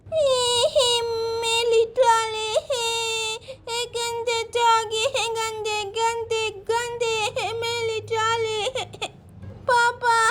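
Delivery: put-on high, slow babyish whine; whining toddler. Munna scolds dog for taking trolley, whining toddler voice. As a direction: draw it out like a tearful small child.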